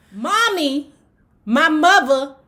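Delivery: ordinary talk, nothing marked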